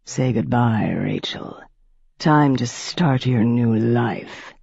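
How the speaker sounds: a predator savoring every word; menacing old vampire. A narrator's reading. Piscary before biting.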